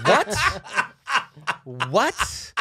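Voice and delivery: high, slow disbelieving echo; stunned disbelief. Retold or imitated. Steve reacting to the host's question, stunned. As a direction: repeat it back in sheer disbelief.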